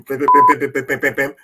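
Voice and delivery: mimicking a phone ring with pim pim; loud imitated ringtone; phone ringing imitation. Imitating the Illuminati phone ringing. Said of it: "this is how the phone call will go".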